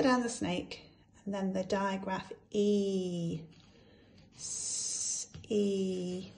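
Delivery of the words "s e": each sound said apart, slow and separate; segmented phonics sounds; sounding out letters. Shown as a demonstration. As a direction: break the word into its sounds. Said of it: "So let's sound it out".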